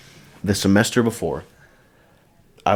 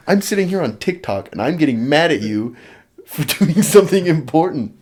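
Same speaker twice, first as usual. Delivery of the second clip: laughing self-mockery; 8 semitones higher, 7 dB louder than usual. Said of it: "and you're just like".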